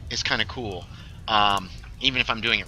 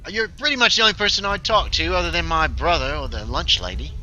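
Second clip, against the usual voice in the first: slightly acted confession, pitched up; lightly voiced game character; 7 semitones higher than usual. Admitting who they talk to, lightly voiced character.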